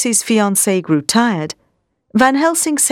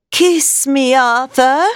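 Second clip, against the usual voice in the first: higher, strange pleading voice, not Lucy's own; 5 semitones higher, much slower than usual. A narrator's reading. Undead Lucy begging Arthur for a kiss, eerie plea. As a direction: coax him in an eerie plea. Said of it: "she pleaded".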